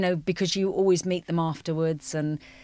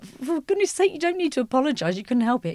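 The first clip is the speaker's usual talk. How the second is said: high, loud, fast disbelieving reassurance; exasperated reassurance; 9 semitones higher, 4 dB louder, faster than usual. Retold or imitated. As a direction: throw up your hands kindly. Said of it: "We were like".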